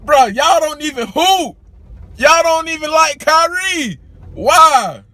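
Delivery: high-pitched, fed-up call-out at the resellers; exasperated outburst. Real, not acted. Venting at resellers over sold-out sneakers.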